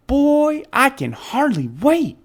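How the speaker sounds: put-on high, eager boy voice; can't wait, bursting with it; excited boy. A narrator's reading. Steve excited.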